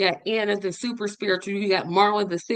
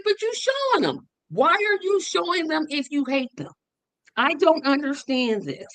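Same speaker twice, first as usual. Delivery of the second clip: high-pitched, incredulous re-enactment; baffled retold protest; 7 semitones higher than usual. Host asking Marlon why he shows videos he hates, baffled. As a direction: act out arguing, starting very high and easing down.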